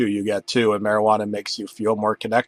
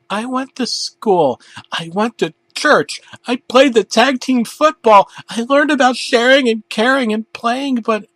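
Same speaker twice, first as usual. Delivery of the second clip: much higher, rising distress; anguished rant; 12 semitones higher, 5 dB louder than usual. Acted. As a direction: cry out a ruined life. Arthur's despairing rant.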